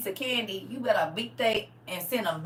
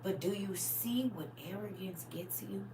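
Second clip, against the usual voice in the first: unvoiced, much softer whisper; hushed whisper; 2 semitones lower, 17 dB quieter, slower than usual. Real, not acted. Preacher whispers to the congregation about arrogance, hushed. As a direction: lean in and whisper the lesson.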